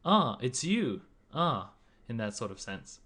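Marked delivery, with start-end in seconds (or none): 0.0-1.7 ah, it's you, higher and louder; 认出人的惊喜; recognition. Shown as a demonstration. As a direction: greet someone you suddenly recognize. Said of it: "an expression of recognition".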